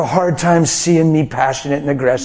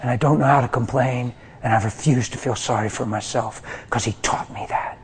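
quiet; 5 semitones lower, 6 dB quieter than usual. Real, not acted. Quiet earnest confession.

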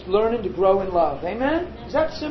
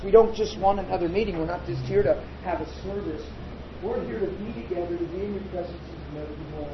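lower, hushed spontaneous prayer; 4 semitones lower, 6 dB quieter than usual. Real, not acted. Preacher praying over the gathering, soft earnest prayer. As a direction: talk softly and earnestly to God.

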